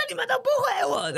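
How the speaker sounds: whiny complaint. Retold or imitated. Mock muted host complaining.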